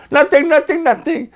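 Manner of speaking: high, weepy denial; tearful daughter. Retold or imitated. Daughter on the phone, crying, high-pitched and tearful. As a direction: brush it off through tears. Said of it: "called me crying".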